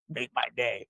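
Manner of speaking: low, quiet gravel-free growl of a tough cop; lightly voiced mock Eastwood menace; hint of a movie tough guy, low and soft; Clint Eastwood. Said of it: "like Clint Eastwood did".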